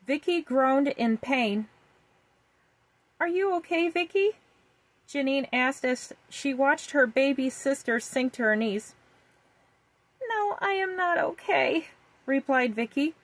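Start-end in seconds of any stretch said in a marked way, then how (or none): 3.2-4.4 worried sister. A narrator's reading. 10.3-11.9 put-on high little girl voice, crying in pain; sobbing little girl with a tummy ache. A narrator's reading. Vicky in pain.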